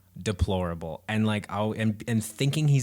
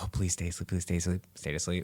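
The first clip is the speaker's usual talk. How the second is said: pleading hush; 3 semitones lower, 8 dB quieter than usual. Retold or imitated. Parents begging baby stays asleep.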